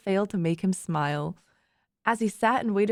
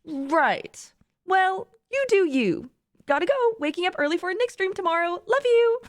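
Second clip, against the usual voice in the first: high-pitched, sing-song brush-off; airy, knowing goodbye, pitched up; breezy friend signing off; 8 semitones higher than usual. A narrator's reading. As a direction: brush it off and ring off in a high chirp. Friend breezily ending the call in story.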